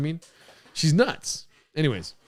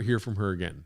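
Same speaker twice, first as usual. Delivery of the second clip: ordinary talk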